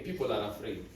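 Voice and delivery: dropped right down, soft and low; a quiet confession to the congregation; sudden hush. Real, not acted. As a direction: lean in and say it quietly. Preacher lowers voice.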